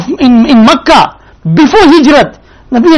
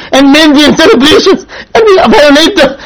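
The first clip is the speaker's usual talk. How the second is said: usual speaking voice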